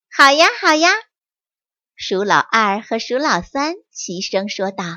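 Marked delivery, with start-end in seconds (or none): 0.1-0.9 eager agreement. Acted. Brothers answer together happily.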